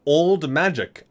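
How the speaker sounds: ordinary talk, nothing marked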